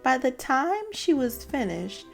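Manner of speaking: plain reading voice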